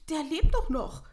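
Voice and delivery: loud, high-pitched disbelief; startled objection. Acted. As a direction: protest in astonishment. Mayor startled at living man's gravestone.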